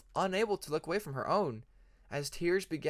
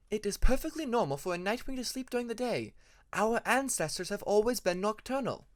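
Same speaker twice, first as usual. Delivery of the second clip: higher, lightly voiced firm mother; mother lecturing; 7 semitones higher than usual. A narrator's reading. Mother telling young Peacemaker why Nightwings sleep by day, firm and matter-of-fact. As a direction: set him straight like a patient mother. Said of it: "she told him more than once".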